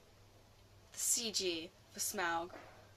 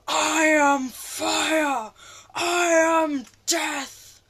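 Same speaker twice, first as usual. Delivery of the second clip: loud, very slow, higher dragon proclamation; mock Smaug voice; 6 semitones higher, 12 dB louder, much slower than usual. Fan retelling Smaug's last line, slow hammy dragon boom. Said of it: "It's just like".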